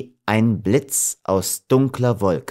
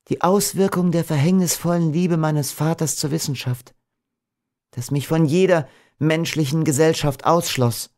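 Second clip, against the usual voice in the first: higher-pitched heroine confessing her fate; tender, sorrowful Beatrice; 7 semitones higher than usual. A narrator's reading. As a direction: confide in a high, wistful voice.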